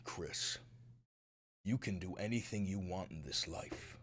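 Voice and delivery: a father's grave secret whispered to his son; low whispered fatherly plea; dad whispering warning. Retold or imitated. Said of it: "him being like".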